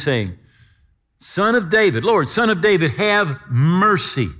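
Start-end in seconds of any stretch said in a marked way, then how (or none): 1.2-4.3 loud, high-pitched plea for mercy; crying out. Acted. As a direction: call out over a passing crowd, drawing out the last words. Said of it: "and he's crying out".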